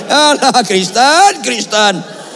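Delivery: high, loud mock lament; exasperated cry. Real, not acted. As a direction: cry out in comic despair.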